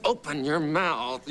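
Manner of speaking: imitating the fussy dialogue coach; drawn-out command. Coach orders the pupil, slow, high and stretched. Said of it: "He says".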